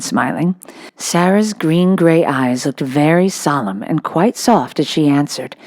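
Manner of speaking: usual speaking voice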